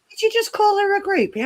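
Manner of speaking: very high-pitched incredulous mum; imitated shocked mum. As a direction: act out a mother's high, scandalised question. Mum's shocked reaction, retold.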